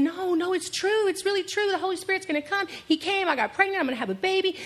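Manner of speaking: high, earnest insisting voice; Mary begging to be believed; pleading Mary. Retold or imitated. Preacher voicing Mary insisting to Joseph, high and pleading.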